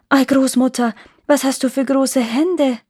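a touch of storybook astonishment; wondering girl. A narrator's reading. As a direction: marvel lightly as Rotkäppchen. Rotkäppchen marvels at hands.

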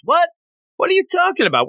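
high, shocked Inosuke outburst. Retold or imitated. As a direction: pitch jumps way up in disbelief. Inosuke shouting in disbelief.

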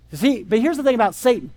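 ordinary delivery

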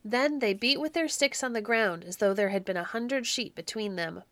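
ordinary delivery, nothing marked

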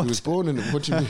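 high, loud disbelief; what-do-you-mean outburst; incredulous. Acted. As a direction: burst out in mock disbelief. Mock incredulous reaction about Apu.

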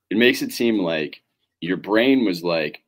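ordinary talk, nothing marked

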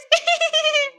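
short playful giggle. Acted. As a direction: laugh at your own joke. Laughing at her own joke.

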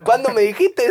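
agudo, pregunta exagerada; vendedor sorprendido. Retold or imitated. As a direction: imita al vendedor desconcertado. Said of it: "Así". (vendedor pregunta de nuevo el precio, agudo y exagerado)

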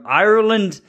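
playful imitation of an Irish accent. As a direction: lightly put on an Irish accent. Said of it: "imitate the Irish accent".

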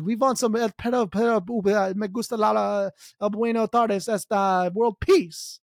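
a jokey Colombian beauty queen mixing Spanish words; mock Colombian accent. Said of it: "sexy Colombian, she's like".

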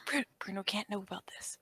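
secretive whisper. Acted. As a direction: hide it from Bruno in a whisper. Secret kept from Bruno.

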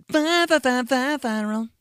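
high, loud stuttered intro; stutter jingle. Pretend. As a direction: stutter it like a DJ drop. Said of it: "stuttered jingle intro".

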